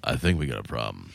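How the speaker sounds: very low, quiet deadpan; hushed low-pitched imitation of a friend; Darren impersonation. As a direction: drop way down and understate it. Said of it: "in my best Darren impersonation".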